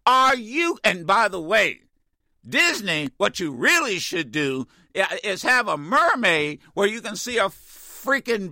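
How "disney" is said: normal talking voice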